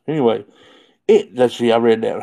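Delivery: ordinary delivery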